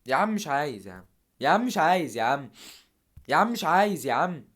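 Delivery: escalating exasperated shout; rising frustrated yell. Real, not acted. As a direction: build from a complaint to a loud protest. Repeating complaint at the game.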